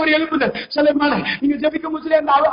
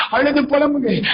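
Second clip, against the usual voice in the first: usual speaking voice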